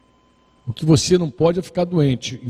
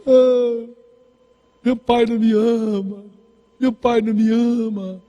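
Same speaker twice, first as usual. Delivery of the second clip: mock crying complaint; 5 semitones higher, slower than usual. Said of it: "chegou lá em casa chorando e falou assim".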